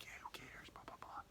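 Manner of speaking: very soft, careless whisper; mock hushed dismissal; whispered brush-off. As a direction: imitate a girl whispering dismissively. Girl's hushed reply retold.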